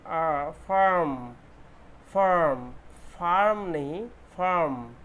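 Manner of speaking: फार्म with long open आ, loud; long aa in farm. Shown as a demonstration. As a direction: stretch the आ in फार्म. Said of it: "आ sound".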